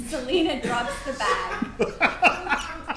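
stage direction read through laughter; can't keep a straight face reading it; laughing narration. Real, not acted. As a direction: narrator cracking up mid-line.

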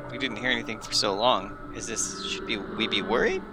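usual speaking voice

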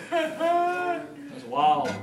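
high, laughing amazement; amused reply. Retold or imitated. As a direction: laugh it out, half disbelieving.

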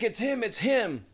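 high, urgent identification; retold calling out. Woman telling police she has found the suspect, high urgent call. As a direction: raise the pitch to alert the others.

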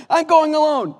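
high, very loud mock-hobbit cry; hammed-up heroic shout; Frodo. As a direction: insist melodramatically at high pitch. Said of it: "He goes".

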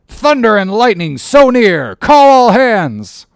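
high, loud, slow on call all hands; captain's roaring order. Acted. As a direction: rouse the whole ship. Captain rouses the crew.

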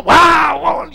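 shouted high wows like a football fan; a father roaring for Mexico in the stands; stadium fan cheer. Retold or imitated. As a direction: leap up cheering a goal.

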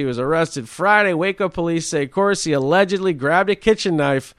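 mock anchor reading the headline; news read. Reads news story aloud.

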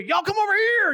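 high, loud farmhand holler; loud, high-pitched summons; calling out. Acted. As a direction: yell it across the pasture to the herd. Imitates Billy Bob calling cows.

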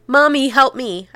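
contained storyteller's shriek; narrated shriek. A narrator's reading. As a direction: call out to mom in alarm, stopping short of a scream. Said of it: "I shrieked".

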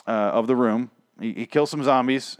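ordinary talk, nothing marked

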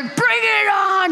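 very high, loud, slow gleeful yell; excited shout. Retold or imitated. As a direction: scream it at the top of the ride. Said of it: "Cora's back there".

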